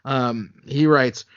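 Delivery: ordinary talk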